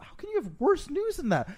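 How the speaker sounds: high, loud, frantic patient; panicked disbelief. Acted. Patient panicking at the doctor about worse news, high and loud. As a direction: yelp it out in disbelief.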